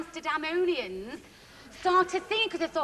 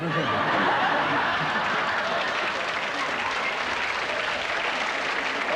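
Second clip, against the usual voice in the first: long low unvoiced laughing; big laugh; 12 semitones lower, 5 dB louder than usual. Real, not acted. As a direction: howl with laughter. Laughs after punchline.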